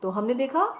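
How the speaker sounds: usual speaking voice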